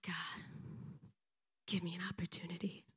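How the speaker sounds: hushed, whispered plea; whispered prayer. Retold or imitated. Woman at her window asking God for an opening, whispered prayer. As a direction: act out praying under your breath.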